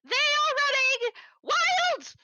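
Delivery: high, slow, drawn-out panicked wail; frantic goblin. Acted. Goblin warning Angeline about the chickens, wailing. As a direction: stretch it out in alarm. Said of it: "And he goes".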